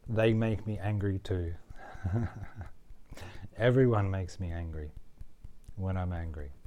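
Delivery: steady, measured affirmations; walk them through accepting the anger; tapping script. Acted. Tapping coach leading repeat-after-me phrases, measured and steady.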